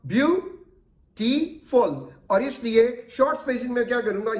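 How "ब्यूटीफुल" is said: slow demo word. Shown as a demonstration. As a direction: say "beautiful" very slowly, each syllable drawn out. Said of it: "मैं कह रहा हूं". ("Beautiful" बहुत धीरे, खींचकर बोलें)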